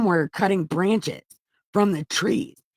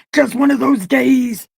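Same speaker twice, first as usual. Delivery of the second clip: high, loud, slow wrestling-promo growl; wrestler entrance line; 8 semitones higher, 9 dB louder than usual. Acted. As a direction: deliver it like a wrestler entering the ring. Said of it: "his Stone Cold vest".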